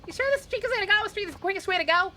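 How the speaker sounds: high, sharp-tongued Southern woman; a bossy lady calling the driver a dolt; sassy Southern lady passenger. Acted. Passenger scolding the taxi driver, high Southern lady voice.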